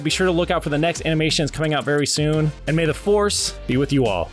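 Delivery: ordinary talk, nothing marked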